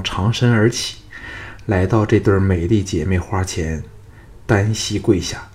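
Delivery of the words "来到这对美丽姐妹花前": plain reading voice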